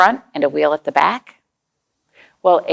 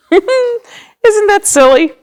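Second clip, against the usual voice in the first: slow, high, laughing delight; 13 semitones higher, 6 dB louder, much slower than usual. Real, not acted. Teacher laughs with children at the silly ending.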